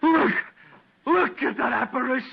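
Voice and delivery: high frightened shout at the apparition; terrified cry. Acted. Stewart sees the walking dummy, high terrified cry. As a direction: shriek it out in sudden terror.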